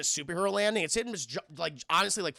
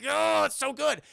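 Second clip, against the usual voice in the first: mock delight; 8 semitones higher, 5 dB louder than usual. Voicing Logan's lustful reaction.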